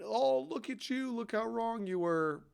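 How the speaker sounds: high, slow, sing-song mockery; mocking taunt. Fans gloating over old tweets, high slow mocking voice. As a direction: drag it out like a smug heckler.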